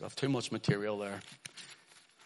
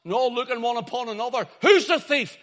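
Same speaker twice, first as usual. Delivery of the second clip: loud, high-pitched excited storytelling; impassioned pulpit storytelling at high pitch; dramatic preaching; 12 semitones higher, 10 dB louder than usual. Real, not acted. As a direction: raise the voice to act out a startled crowd. Preacher dramatises the startled crowd.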